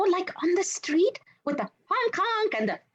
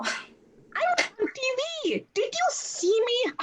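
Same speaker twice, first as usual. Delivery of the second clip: excited; 6 semitones higher than usual. Real, not acted. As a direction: savour the moment, beaming. Thrilled to be on TV.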